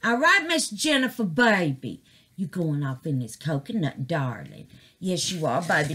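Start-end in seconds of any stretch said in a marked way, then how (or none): none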